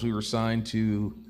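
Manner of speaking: ordinary talk, nothing marked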